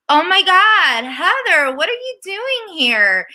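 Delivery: high, gushing fake astonishment; mock surprise. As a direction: exclaim in hammed-up shock at an unexpected guest. Acting out surprised greeting to Heather.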